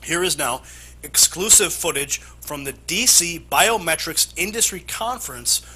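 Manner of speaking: emphatic anchor intro, key words punched high; animated, punchy newscast delivery; news read. Acted. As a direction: sell the exclusive report like a TV anchor. Anchor introduces exclusive report.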